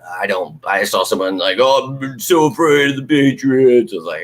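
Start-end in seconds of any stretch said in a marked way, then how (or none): 1.6-3.9 slow, louder mock whine; whiny fan. Mocking a whiny commenter afraid of the Patriots, slow mock whine. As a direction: drag it out like a scared whiny fan. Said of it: "the whiny people".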